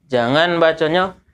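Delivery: ordinary talk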